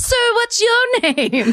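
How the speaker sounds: very high, slow, sung-out call; hammed-up fairy-tale damsel calling down in a high voice; Rapunzel at the window. Woman leaning out a window to a stranger, hammed-up high call. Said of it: "leaning out a window, like".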